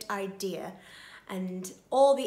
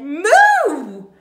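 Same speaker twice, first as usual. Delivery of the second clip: big loud, high moo; cow moo; 7 semitones higher, 16 dB louder than usual. A narrator's reading. Alarmed cow hears of the plot, loud mooing. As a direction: bellow out a moo. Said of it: "the cow said".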